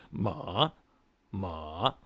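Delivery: MA dipping low, then bouncing up; third tone. Shown as a demonstration. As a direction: drop Ma low and swing it back up. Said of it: "I call it the dipper tone".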